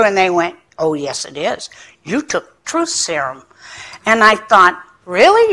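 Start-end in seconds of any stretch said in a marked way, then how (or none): 0.8-3.4 낮고 작게 단정하는 직원 목소리; 조용히 못박듯 말하는 상담원; stern staff voice. Retold or imitated. Staff voiced in retold scene. 5.0-5.5 훨씬 높게 치솟는 믿기지 않는 반문; 어이없다는 듯 높이 튀는 되물음; incredulous really. Retold or imitated. Retold disbelief at staff.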